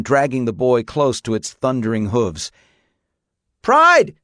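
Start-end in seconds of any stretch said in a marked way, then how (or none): 3.6-4.1 much higher, louder, screechy cry; frightened yell. A narrator's reading. Boy dragged by horse yells its name, scared. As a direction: scream the horse's name in fear. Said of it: "the boy yelled".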